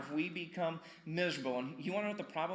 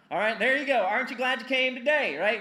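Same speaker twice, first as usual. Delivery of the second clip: loud, higher, playful call to the room; loud teasing; 8 semitones higher, 10 dB louder than usual. Real, not acted. Pastor ribbing his congregation about a simple point, loud and playful. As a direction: rib the crowd loudly.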